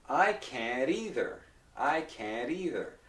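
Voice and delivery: slow, careful demonstration with tapped T. Shown as a demonstration. Teacher helping students listen carefully, slowly. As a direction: stretch it out so learners can hear. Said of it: "I'll say it more slowly".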